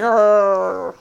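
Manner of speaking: mock angry growl; angry grunt. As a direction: growl it through frustration.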